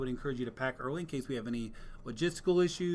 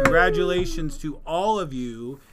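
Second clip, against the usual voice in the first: cheerful announcement; 3 semitones higher, 10 dB louder than usual. Real, not acted. Announcing winners.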